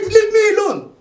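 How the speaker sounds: high loud plea; begging to be left alone; frightened plea. Acted. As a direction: push them off, pleading. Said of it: "He said".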